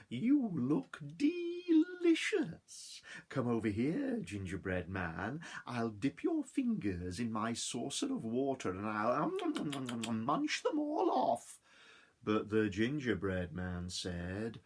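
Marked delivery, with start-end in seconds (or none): 0.1-11.6 high, slow purring cat voice; hungry cat. Acted. Cat tempting the gingerbread man, high and sly.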